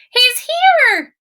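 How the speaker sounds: put-on high, loud excited child cry; child shouting. Lola calling from the window, high and loud. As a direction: shout it like an excited little girl. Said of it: "Lola shouted from the window".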